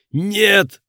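very high, loud desperate scream. Acted. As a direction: scream it in horror. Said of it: "закричал Марк".

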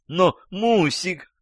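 slow, high-pitched female whine; умоляющая женщина. A narrator's reading. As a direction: protest plaintively, drawing it out high. Женщина жалобно возражает любовнику. (жалобно, высоким женским голосом)

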